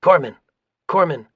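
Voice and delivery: narrator's contained battlefield yell. A narrator's reading. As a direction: call out urgently for a medic.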